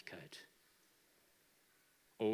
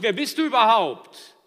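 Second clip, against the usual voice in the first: loud, higher, cocky demand; defiant challenge; 12 semitones higher, 21 dB louder than usual. Acted. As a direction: throw the question back defiantly. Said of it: "Er fragt nicht". (trotzig herausfordernd)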